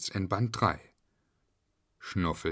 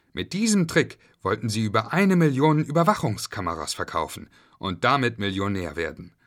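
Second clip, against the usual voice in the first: plain reading voice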